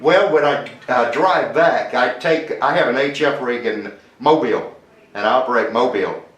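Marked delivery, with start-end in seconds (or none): none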